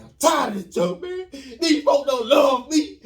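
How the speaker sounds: loud, slow, high sulky tantrum; throwing a fit. Retold or imitated. Angry boy sulking in his room, loud and slow. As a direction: whine it out like a kid. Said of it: "I'm throwing a fit".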